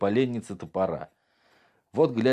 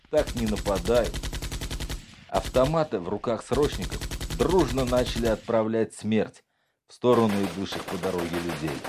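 ordinary delivery, nothing marked

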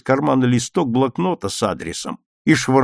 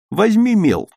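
firm, raised teacher's call; teacher's instruction; 8 semitones higher, 6 dB louder than usual. A narrator's reading. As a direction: give a classroom order, louder and higher. Teacher gives an instruction in class. (учительское указание, громко)

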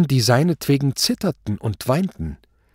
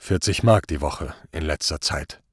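low, subdued answer; sober, low-voiced defendant; impassive accused; 7 semitones lower than usual. A narrator's reading. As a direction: answer the court without emotion.

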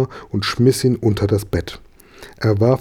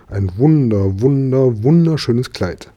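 each "wunder" stressed, climbing in pitch; gushing emphasis; 3 semitones higher than usual. A narrator's reading. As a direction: build up "wunder-, wunder-, wunderschönes" step by step. Mama's gushing wish for a dress.

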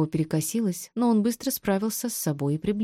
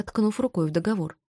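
ordinary delivery

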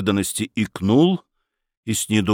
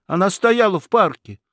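put-on much higher-pitched young man's explanation; flustered explaining; 9 semitones higher than usual. A narrator's reading. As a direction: start explaining yourself in a high voice. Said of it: "начал пояснять Федяшев". (оправдываясь высоким голосом)

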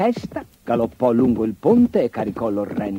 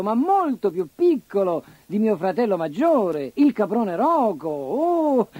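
higher pleading goat voice; 10 semitones higher than usual. Acted. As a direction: coax the ogre nervously.

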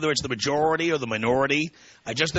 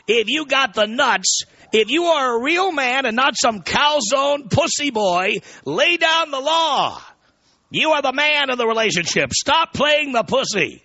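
high, slow, emphatic shouted rant; theatrical rallying shout, pitched way up; hammed-up exhortation; 11 semitones higher, 4 dB louder, slower than usual. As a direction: belt out the orders, stretching the words. Email rant climaxes in exhortation.

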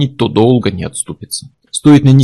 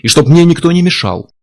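louder, forceful demand; irritated growl; 5 semitones higher, 5 dB louder than usual. A narrator's reading. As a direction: insist like an offended author. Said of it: "рыкнул Курт". (раздражённое требование)

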